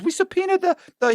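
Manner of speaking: high-pitched stammering comeback; flustered agent. Retold or imitated. Agent defending his subpoena to the teller, flustered. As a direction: imitate a flustered official, voice shooting up.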